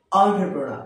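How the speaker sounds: "এন্টারপ্রেনার" said as "on-tre-pro-na"; correct pronunciation. Shown as a demonstration. Teacher giving learners the real form, "এন্টারপ্রেনার" as on-tre-pro-na. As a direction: say "এন্টারপ্রেনার" starting "on-tre", no final r. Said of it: "কি হবে?".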